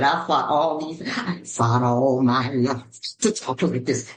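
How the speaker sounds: low, slow, weighty movie-drama voice; deep, drawn-out mock-dramatic declaration; Color Purple impression. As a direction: drop low and ham up a film monologue. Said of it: "I did some Color Purple".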